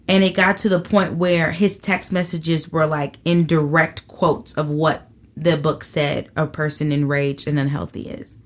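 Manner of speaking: normal talking voice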